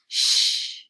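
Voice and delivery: long hushing "sh"; sh sound. Shown as a demonstration. Teacher showing pupils what two letters make, long "sh". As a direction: draw out "sh" as one steady hiss of air. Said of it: "These two letters make the sh sound".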